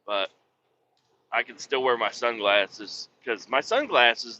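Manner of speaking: ordinary delivery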